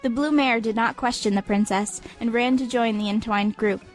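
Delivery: ordinary delivery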